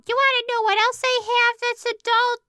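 put-on high squeaky toddler voice; kid voice. A narrator's reading. Toddler character voice.

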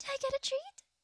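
"I get a treat?" squealed up high and loud with glee; bursting with delight; squealing excitement. Acted. Repeats louder, more excited.

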